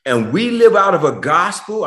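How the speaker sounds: ordinary talk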